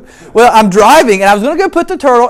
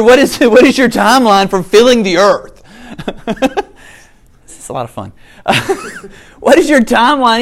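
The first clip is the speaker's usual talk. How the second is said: ordinary talk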